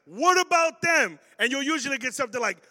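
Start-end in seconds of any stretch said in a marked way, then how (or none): 0.1-1.2 put-on high, loud, drawn-out kid's whine; outraged child crying unfair to parents; whiny protest. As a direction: wail it like a wronged older sibling.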